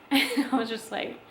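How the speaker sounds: usual speaking voice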